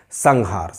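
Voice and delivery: "संहार" said as sang-haar, with an ng; slow demo of "संहार" with ng before the h; velar nasal. Shown as a demonstration. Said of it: "सही उच्चारण है". ("संहार" में ङ की ध्वनि)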